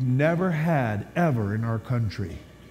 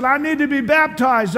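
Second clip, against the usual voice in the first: higher, louder, near-tearful plea; imitating crying; 9 semitones higher, 5 dB louder than usual. Pastor pleading on the phone at midnight, tearful and high. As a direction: retell the tearful begging, worked up. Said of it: "And he's crying on the phone".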